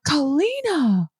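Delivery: drawn-out scolding call of the name; stern warning. Retold or imitated. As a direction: warn the child by name, slow and high. Mother scolding child by name.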